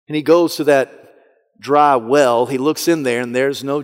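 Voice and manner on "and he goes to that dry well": ordinary talk, nothing marked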